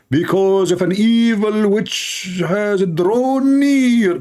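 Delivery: high, slowly drawn-out mock proclamation; slow, high parody of a warning; mock prophecy. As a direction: stretch every word like a hammed-up prophet.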